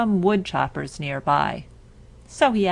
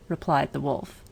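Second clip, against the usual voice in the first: usual speaking voice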